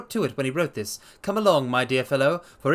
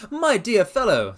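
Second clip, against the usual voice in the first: amused; 8 semitones higher, 6 dB louder than usual. Acted. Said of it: "wore an amused smile".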